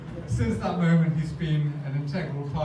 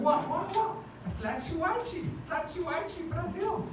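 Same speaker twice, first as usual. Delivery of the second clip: panicked squeal; 3 dB quieter than usual. Retold or imitated. Retold panicked protest to police.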